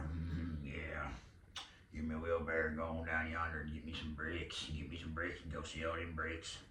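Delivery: low, soft, rural character drone; Billy Bob Thornton in Sling Blade. Acted. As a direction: talk low with grunted mm's between lines. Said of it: "Billy Bob Thornton on, uh, Sling Blade".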